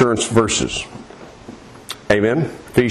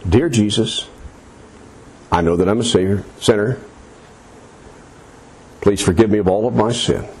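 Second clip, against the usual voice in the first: prayer voice; 6 semitones lower than usual. Acted. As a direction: model the prayer for him to repeat. Models the sinner's prayer.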